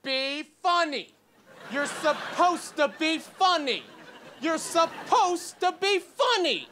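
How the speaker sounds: put-on slow, high drunken heckle, rising each time; mock drunk heckler. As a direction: drag it out like a drunk yelling from the second row. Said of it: "she starts screaming at me".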